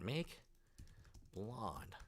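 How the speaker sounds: barely voiced, mumbling as he types; muttered prompt. Real, not acted. Streamer mumbling "make blonde" to himself as he types. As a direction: mutter the word to yourself while typing.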